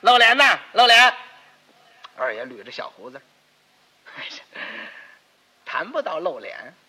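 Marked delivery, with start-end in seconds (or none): 0.0-1.1 loud praise. Acted. Praising the funeral as showy.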